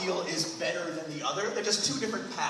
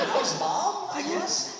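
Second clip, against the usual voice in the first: awkward mock son; 11 semitones higher, much slower than usual.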